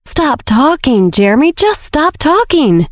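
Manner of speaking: drawn-out, high-pitched self-scolding; exasperated, stretched words rising high; inner shout. A narrator's reading. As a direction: plead with yourself to shut up, slowly. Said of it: "Jeremy shouted at himself internally again".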